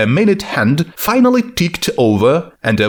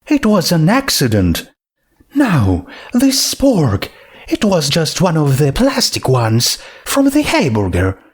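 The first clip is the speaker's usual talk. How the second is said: Rarity voice; 5 semitones higher than usual. Acted.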